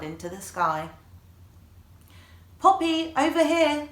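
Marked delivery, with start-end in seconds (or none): 2.6-3.9 high, loud call across a party. A narrator's reading. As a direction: call a friend across the room. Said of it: "Ziba called".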